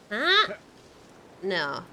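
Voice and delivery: ordinary delivery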